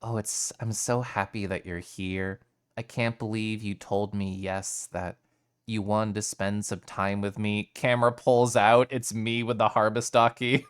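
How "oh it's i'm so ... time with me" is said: hushed, intimate and sweet; soft parasocial voice. Pretend. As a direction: murmur it like a cozy bedside chat. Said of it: "I could just be like".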